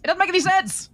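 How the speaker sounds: exasperated emphasis. Real, not acted. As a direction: push it up in exasperation. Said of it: "repeats line louder".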